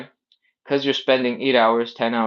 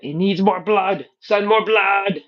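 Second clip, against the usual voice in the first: put-on loud Austrian-accented bodybuilder voice, pitched up; Schwarzenegger impression; 9 semitones higher, 3 dB louder, slower than usual. Trainer playing the body demanding blood, Schwarzenegger-style Austrian accent. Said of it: "I had a Schwarzenegger accent voice impression".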